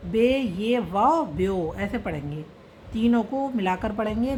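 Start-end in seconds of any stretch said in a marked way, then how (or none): 0.1-1.7 slow spelling out. Shown as a demonstration. Teacher showing students how to read it, slow letter-by-letter. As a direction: slowly sound out बे, ये, वाव, then join them as ब्यो. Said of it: "इसको कैसे पढ़ेंगे".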